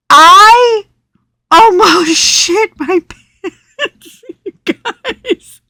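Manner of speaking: high, squealing laughter through the words; laughing hard. Real, not acted. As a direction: barely get it out for laughing. Retelling her fright, laughing.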